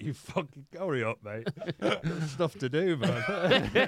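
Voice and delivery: laughing mock scold. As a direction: laugh while you nag him along. Mock friend telling him hurry.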